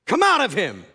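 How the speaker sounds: loud, firm order to the demon; stern command. Acted. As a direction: cast the demon out with force. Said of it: "Jesus said sternly".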